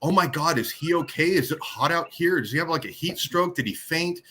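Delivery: high, fretting mock passer-by voices; hammed-up panicked bystander, pitched well up; worried bystanders. Passers-by panicking over a man lying in the street, in high fretting voices. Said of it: "I hear people like".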